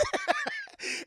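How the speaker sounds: a shrill squeal of laughter; high laugh. Real, not acted. Streamer laughing at the Hulk Jeep, high squeal. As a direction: cackle high in disbelief.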